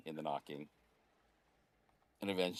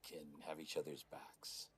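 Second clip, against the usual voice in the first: soft, hushed vow; god whispering loyalty to his kin; solemn whisper; 8 dB quieter than usual. Acted. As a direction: whisper it like an oath. Solemn line to battle kin.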